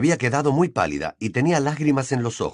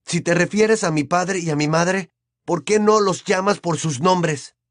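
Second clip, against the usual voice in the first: raised pitch, pointed challenge; Harry, out loud; 8 semitones higher, 3 dB louder than usual. A narrator's reading. Harry challenges his aunt about his parents, loudly. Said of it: "dijo Harry en voz alta".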